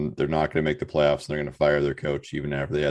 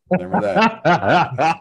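laughing mock warning; 12 semitones higher, 6 dB louder, much slower than usual. Teasing threat, laughing.

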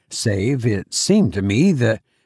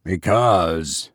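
low, hesitant stranger's voice; stopping short; 7 semitones lower than usual. A narrator's reading. Stranger starts explaining from the boat, stopping short. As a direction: begin to explain, then break off. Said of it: "began the voice and stopped short".

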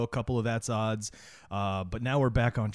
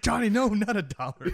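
high mock protest; 9 semitones higher than usual. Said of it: "He's gonna be like".

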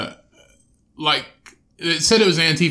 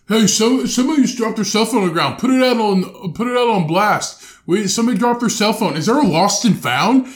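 mocking the protesters, higher and louder; 6 semitones higher, 4 dB louder than usual. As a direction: ridicule them in a silly put-on voice. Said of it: "they're like".

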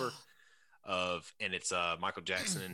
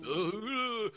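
high, loud zombie moan; 14 semitones higher, 4 dB louder than usual. Pretend. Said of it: "That was my zombie voice".

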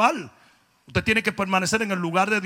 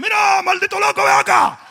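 much higher, much louder shout; Dominican yell; 12 semitones higher, 11 dB louder than usual. Retold or imitated. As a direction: bellow it across a Dominican street. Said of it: "acostumbrado a que te digan". (grito dominicano, muy agudo y fuerte)

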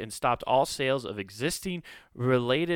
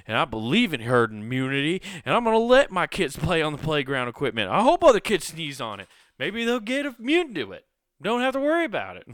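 building louder and much higher into a gleeful rant; mock crusader ranting about herd immunity; mock patriotic rant; 6 semitones higher, 7 dB louder than usual. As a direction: play a defiant patriot getting carried away.

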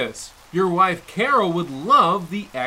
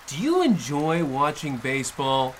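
usual speaking voice

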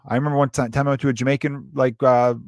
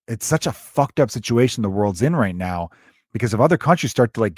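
ordinary delivery

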